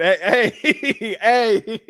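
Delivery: laughing through the words; host laughing at a viewer's callout. Real, not acted. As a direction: call out while cracking up. Laughing at chat.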